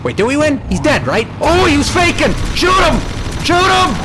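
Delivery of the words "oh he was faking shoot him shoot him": high, urgent yells; alarmed, high-pitched shouting; screaming in panic. Real, not acted. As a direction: scream orders at an enemy that sprang back up. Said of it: "Sorry for all the screaming".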